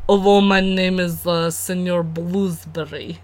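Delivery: put-on Russian, measured and deliberate; mock Russian accent. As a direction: copy a friend's cod Russian character. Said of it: "You sound Russian".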